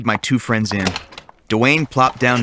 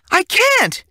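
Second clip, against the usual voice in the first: put-on girl refusing the teacher's Saturday plan; girl's refusal. A narrator's reading. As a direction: protest like a spoiled girl.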